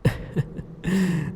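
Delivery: a high playful giggle; delighted laugh. Acted. Demon lord showing the hero the crystal ball, playful giggle. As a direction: giggle at his reaction.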